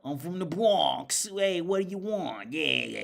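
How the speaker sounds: put-on loud, slow Bronx accent, pitched high; exaggerated New York accent, vowels stretched out. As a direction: put on a hammed-up Bronx tough-guy accent. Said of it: "Nobody else talked like that in".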